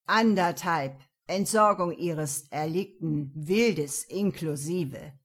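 slow, loud, sly goat man. Acted. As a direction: drawl the deal with menace. Mechanic's sly blackmail. (langsam und lauernd, Ziegenmann)

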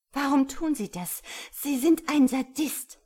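loud, high accusation; furious outburst. A narrator's reading. Woman snaps at the man dragging her, furious. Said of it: "fuhr sie ihn an".

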